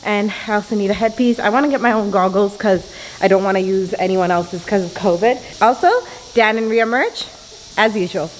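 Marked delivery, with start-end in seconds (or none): none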